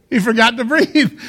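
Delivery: loud laughing retort; laughing punchline. Retold or imitated. Answering the kid with his dad's line, laughing. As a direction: deliver it laughing at yourself.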